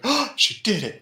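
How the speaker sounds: high, awed mock gasp; mock awed surprise. Lag watching her knock them out, high mock awe. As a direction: marvel at it like you can't believe it. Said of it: "Lag's all like".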